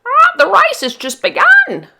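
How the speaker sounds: a cockatoo urging the boat on; cockatoo cry. Acted. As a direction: screech it like a cockatoo. Cockatoo shouting.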